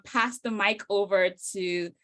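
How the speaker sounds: normal talking voice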